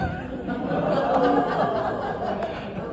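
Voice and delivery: high airy laugh at own joke; giggle, almost silent; high breathy laugh. Real, not acted.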